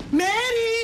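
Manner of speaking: high, loud call across the house; calling Meri through the house. Real, not acted. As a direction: shout her name from another room.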